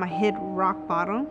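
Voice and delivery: normal talking voice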